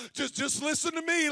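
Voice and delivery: normal talking voice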